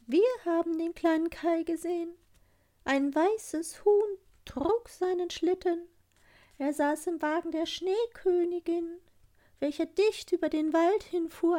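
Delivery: sehr hohe, fremd klingende Taubenstimme; gurrende Waldtauben. Acted. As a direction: gurr wie eine Taube, hoch und singend. (Waldtauben gurren zu Gerda, sehr hoch)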